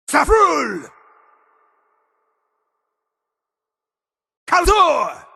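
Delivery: high, booming shout with falling tail; full shout. Acted. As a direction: blast each word out and let it drop. Isolated battle shouts in game.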